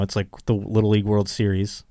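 ordinary delivery

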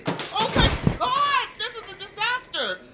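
panicked shriek. Acted. Luggage thrown in the trash.

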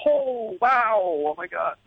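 high, drawn-out wow; awed reaction. Retold or imitated. Recalling a glimpse of [name], awestruck. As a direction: stretch it out in wonder, starting high.

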